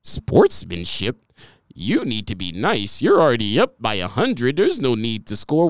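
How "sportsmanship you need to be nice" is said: lower, slow, prim voice mocking the critics; mock prissy critic. Mocking critics who preach sportsmanship, prim and slow. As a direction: drawl out the scold like a sanctimonious fan.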